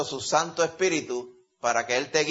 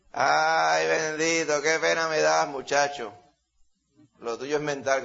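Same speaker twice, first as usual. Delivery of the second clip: mocking laugher; slower than usual. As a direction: cackle at someone and pity them with scorn. Acting out mockers laughing.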